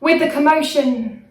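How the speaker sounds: measured, emphatic poetry performance; spoken-word weather forecast. Acted. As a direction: deliver the poem like a grave weather warning.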